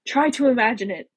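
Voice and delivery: a touch of forced patience; ground out. A narrator's reading. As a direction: push it out with contained irritation. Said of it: "she ground out".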